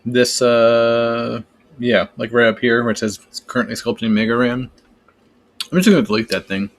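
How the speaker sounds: talking with a mouthful; eating while talking. Real, not acted. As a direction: chew while you speak. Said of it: "Sorry, I'm eating and talking on the stream".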